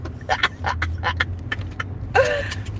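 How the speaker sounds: breathy high-pitched giggle. Real, not acted. Viewer recalling the funny live, squeaky giggle. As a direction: let a squeaky laugh out.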